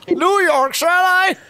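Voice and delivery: high, slow drawn-out catchphrase; hammed-up cowboy cry; commercial cowboy holler. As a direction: holler it across the campfire. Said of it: "all the fucking cowboys".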